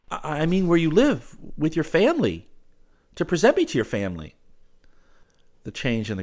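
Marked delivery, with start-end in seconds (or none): 0.1-4.3 loud, high, stammered clarification; flustered suitor. Acted. He explains his request to the girl, flustered. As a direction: explain hastily, pitch raised.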